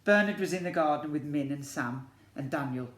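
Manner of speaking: lively picture-book storytelling; story narration. A narrator's reading. Teacher reads to year one in storybook narration. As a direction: read it to the class like a storybook.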